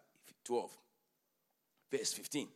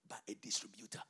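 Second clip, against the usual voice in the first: dramatic whisper; 6 semitones lower, 6 dB quieter than usual. Acted. Preacher hushing the congregation for the key line, whispering. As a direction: drop to a hush for the point.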